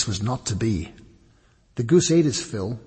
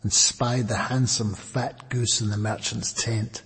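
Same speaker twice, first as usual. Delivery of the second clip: ordinary delivery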